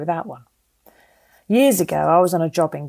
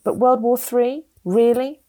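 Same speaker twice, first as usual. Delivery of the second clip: higher, slower mock disbelief; louder voiced scepticism from an imagined listener; mock incredulous reader; 6 semitones higher, 3 dB louder, slower than usual. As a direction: play the doubting reader, incredulous. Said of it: "I can hear you say".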